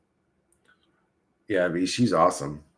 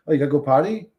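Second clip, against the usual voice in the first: ordinary talk, nothing marked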